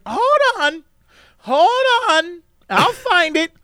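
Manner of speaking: high, stalling mock voice; imitating a flustered woman. Mocking the woman stalling the security line, high and distracted. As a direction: brush him off, high and distracted. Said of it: "she's just like".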